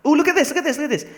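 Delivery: high, thrilled squeal; excited. Real, not acted. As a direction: point it out with delight. Excited find in game.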